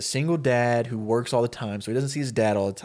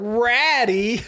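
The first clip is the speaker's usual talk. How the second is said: high, laughing incredulous question; mocking echo; 10 semitones higher, 4 dB louder than usual. Bullies hearing Ben's pet name for Rattata, derisive echo. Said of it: "they hear that, and they're like".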